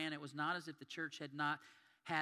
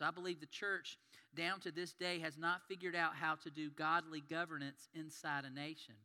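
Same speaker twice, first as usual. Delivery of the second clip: normal talking voice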